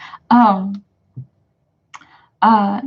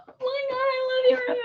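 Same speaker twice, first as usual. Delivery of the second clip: high soft gushing voice; 7 dB quieter than usual. Retold or imitated. Gushing line in a retold scene.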